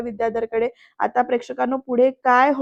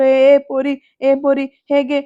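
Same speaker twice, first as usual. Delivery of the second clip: loud, raised-pitch hammed-up demand; imitated shouting; 3 semitones higher, 6 dB louder, slower than usual. Vidyadhar demanding the girl beat him, hammed-up shout. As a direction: shout it like a guilt-show, mock frantic.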